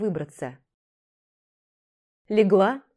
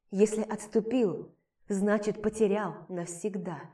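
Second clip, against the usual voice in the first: resigned quiet; 5 dB quieter than usual. Acted. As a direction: let it fade out. Runner answers, resigned.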